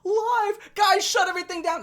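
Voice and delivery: high, loud mock panic with a fake whimper; hammed-up frightened cry, pitched high. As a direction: ham up a streamer panicking on air. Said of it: "supposed to, like, stifle him".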